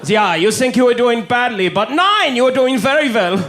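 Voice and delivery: put-on German accent. German tourist voice continued.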